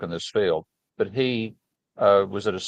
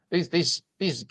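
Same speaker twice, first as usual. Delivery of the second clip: lightly put-on Austrian accent, a touch higher; a hint of a dismissive Austrian professor; mock Austrian accent, scornful; 4 semitones higher than usual. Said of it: "my best imitation of an Austrian accent".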